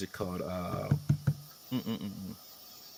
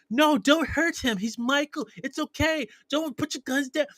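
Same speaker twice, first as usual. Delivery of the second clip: slow, much higher pleading woman's voice; 12 semitones higher than usual. Retold or imitated. Woman begging guards not to hurt him, slow high plea. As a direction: beg them slowly, pitch way up. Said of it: "she's like".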